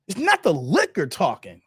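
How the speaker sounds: ordinary talk, nothing marked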